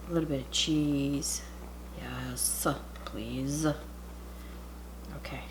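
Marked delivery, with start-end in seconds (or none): 2.0-2.7 soft whispered aside; hushed whisper while stuffing. Real, not acted. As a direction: whisper it to yourself.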